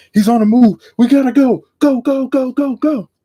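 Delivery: very high and loud, rapid "go, go"; urgent shout. Retold or imitated. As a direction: yell to scramble the team. Calling chase to Mike.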